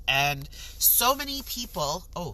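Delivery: ordinary talk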